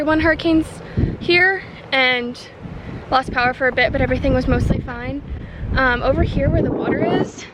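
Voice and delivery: talking loudly over strong wind; windy clifftop. Real, not acted. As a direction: raise your voice over gusting wind. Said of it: "Sorry if you can't hear me over the wind".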